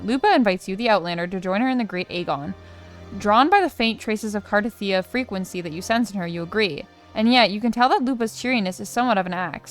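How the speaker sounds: dramatic read-aloud, stresses lifted on key words; story narration. Acted. Reads quest story text aloud.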